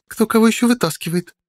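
high, giggly tipsy voice; drunk. A narrator's reading. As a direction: tease her while tipsy. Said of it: "пьяно хихикнула Кирия".

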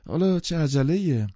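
eager protest, high and loud; insistent. A narrator's reading. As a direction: urge the guests to stay, voice lifted. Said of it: "گتسبی اصرار کرد".

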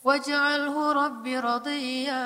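slow, melodic chanted recitation; Quran recitation. Acted. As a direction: chant the verse slowly and tenderly. (lantunan ayat yang pelan dan merdu)

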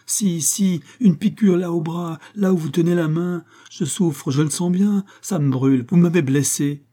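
high, agitated pained protest; distressed insistence. A narrator's reading. Wounded woman accuses the master, distressed insistence. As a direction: pile up the complaints urgently, pitched high.

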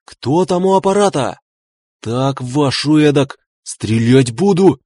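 high, threatening yell with slowed words; shouting. A narrator's reading. Gusev threatens whoever is at the aircraft, shouting. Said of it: "закричал он".

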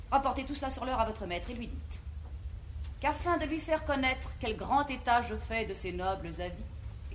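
ordinary talk

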